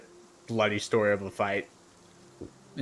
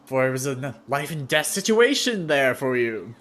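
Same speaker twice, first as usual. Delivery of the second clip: higher and louder, slow and insistent; the proud fighter defending his tale; boastful warrior insisting; 5 semitones higher, 8 dB louder than usual. Acted. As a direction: puff up and insist it was life or death. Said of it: "He's like".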